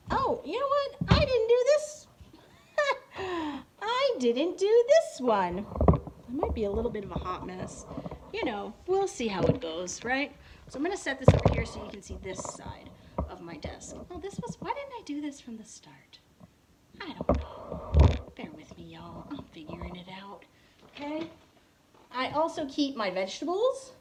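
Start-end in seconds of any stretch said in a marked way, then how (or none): 0.1-5.6 high, loud oh-no realization; surprised realization. Real, not acted. Realizes she forgot setup. 10.7-21.3 unvoiced whisper while busy; whispered asides as she rearranges. Real, not acted.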